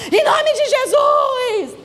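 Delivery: high, drawn-out cry of invocation; exaggerated deliverance shout, pitched high; mock fervent prayer. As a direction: wail it like an overzealous intercessor. Mock frantic prayer over upset child.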